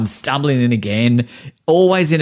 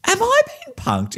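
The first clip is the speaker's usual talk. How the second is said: high, slow incredulous question; mock disbelief. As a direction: act stunned, as if pranked. Incredulous aside, odd service.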